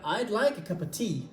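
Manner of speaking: "like", "cup", "tea" stressed, "a" and "of" swallowed; English rhythm. Shown as a demonstration. Teacher models rhythm for learners, "like cup tea" stressed, rest reduced. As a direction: bounce on "like cup tea", eat the small words. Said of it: "la rythmique, c'est le fait de faire".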